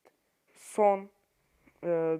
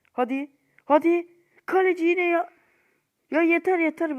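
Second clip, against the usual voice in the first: higher, louder fed-up shouting; frustrated yell; 10 semitones higher, 7 dB louder than usual. Real, not acted. Gamer yelling as the keeper saves again, exasperated.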